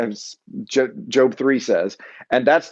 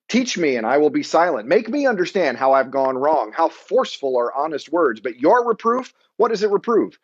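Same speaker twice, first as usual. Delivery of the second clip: impassioned scripture reading; 4 semitones higher than usual. Acted. Reads Job's impassioned rebuke.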